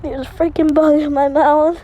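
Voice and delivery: loud, panicked disgust; shocked yell. Real, not acted. Bug flew into mouth while riding.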